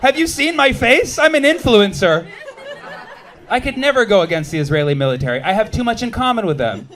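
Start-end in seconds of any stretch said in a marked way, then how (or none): 0.0-2.3 higher and louder, mock disbelief; incredulous protest. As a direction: act stunned that they'd even ask. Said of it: "I'm like".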